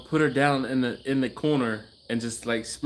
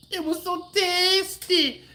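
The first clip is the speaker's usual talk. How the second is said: high mock Trinidadian voice of the kid taster; Trinidadian accent imitation; slower than usual. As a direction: ham up a Trinidadian child's delight. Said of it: "I do a horrible Trinidadian accent".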